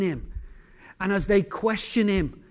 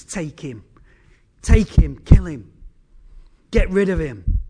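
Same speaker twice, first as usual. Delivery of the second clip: hateful urging; 3 semitones lower, 7 dB louder than usual. Acted. Satan spitting hatred into ears.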